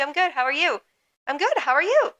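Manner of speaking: very high, sing-song reply; chirpy repeating small talk; customer service voice. Retold or imitated. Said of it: "We just kept going back and forth".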